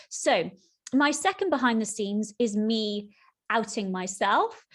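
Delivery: ordinary talk, nothing marked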